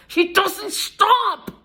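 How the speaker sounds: high, slow, cracking into a sob-laugh; real despair breaking through the words; the line falls apart between laughing and crying. Real, not acted. Distressed rant, voice cracks.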